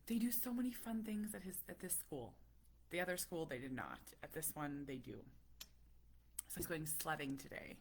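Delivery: usual speaking voice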